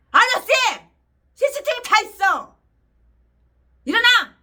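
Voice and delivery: loud, high angry yell at the driver; furious re-enacted shouting; retold shout. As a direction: bark it at a cheating cabbie.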